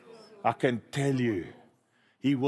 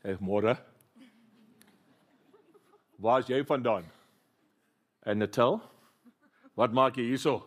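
put-on Afrikaans accent; 3 semitones lower than usual. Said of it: "guys here".

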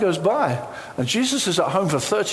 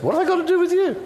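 much higher possessed voice crying out; demonic confrontation; 13 semitones higher, much faster than usual. Acted. Demon shrieking at Jesus in confrontation. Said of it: "this is what he says".

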